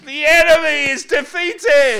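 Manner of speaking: high, slow, drawn-out victory cry; triumphant shout. Real, not acted. Preacher celebrating the crossing to the congregation, triumphant shout. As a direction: preach it at full force.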